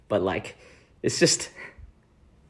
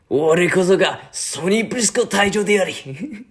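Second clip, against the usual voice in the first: high, booming anime boast in Japanese; grand self-introduction; Sonny Briscoe impression; 10 semitones higher, 4 dB louder than usual. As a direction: proclaim it like an anime captain. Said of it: "first, Sonny Briscoe".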